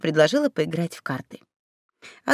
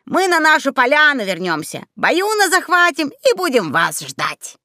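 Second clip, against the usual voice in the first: higher-pitched character voice, half excusing, half cheering on; high, coaxing promise; apologetic encouragement; 6 semitones higher than usual. A narrator's reading. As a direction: reassure them while making excuses. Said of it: "попытался то ли оправдаться, то ли приободрить".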